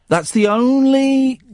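"only" punched high and loud; stress on only. Real, not acted. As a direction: lean hard on "only". Host repeats the rule emphatically.

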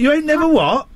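high incredulous question, "never" stretched up; mock disbelief at the lyric. Real, not acted. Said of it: "He didn't sing any lyrics then".

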